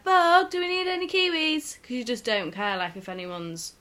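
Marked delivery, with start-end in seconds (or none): 0.0-1.8 high, loud call across the shop; loud carefree call. Retold or imitated. Carefree friend calling across the shop, loud and high. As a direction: belt it out without a care. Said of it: "you're like".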